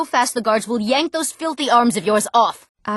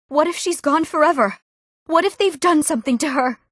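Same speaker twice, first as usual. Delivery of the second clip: raised pitch, worried pleading; higher, worried voice; anxious parent; 6 semitones higher than usual. Acted. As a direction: fret aloud over a missing child. Parent fearing for lost daughter.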